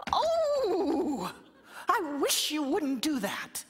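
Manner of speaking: high, thin little-creature voice; small high fairy-folk plea; wee voice. Acted. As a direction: squeak it out like a tiny fellow under the wall. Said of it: "a wee voice says".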